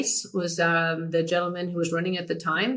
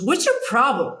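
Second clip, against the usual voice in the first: higher, indignant mock demand; John McEnroe; 5 semitones higher than usual. McEnroe confronting his interviewer after a match, indignant. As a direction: challenge her like an irritated star athlete. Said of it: "hear that tone of John".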